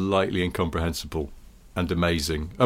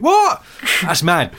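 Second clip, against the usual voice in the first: high-pitched shout of disbelief; be loudly flabbergasted; hammed-up amazed yelp; astonished presenter; 7 semitones higher, 5 dB louder, slower than usual. Presenter amazed by tyre makers.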